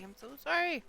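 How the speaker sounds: ordinary talk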